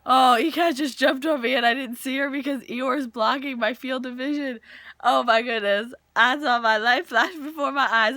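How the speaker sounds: loud, flustered exclaiming; real fright turning comic; startled. Real, not acted. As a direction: exclaim as if just jumped on. Startled by pet jumping on her.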